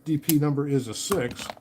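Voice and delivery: normal talking voice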